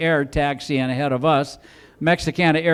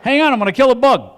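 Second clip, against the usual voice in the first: high and loud, cocky; gleeful pretend line; 8 semitones higher, 6 dB louder than usual. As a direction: swagger through the line. Playful pretend scene.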